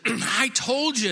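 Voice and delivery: slow, high-pitched insistence; exasperated protest. Acted. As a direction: drag it out in acted exasperation. Balaam protests again to Balak.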